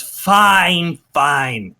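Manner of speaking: grudging goblin chief, lightly voiced; slow goblin boss agreeing to a price. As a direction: drag out the haggling like a petty goblin boss. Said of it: "and he goes".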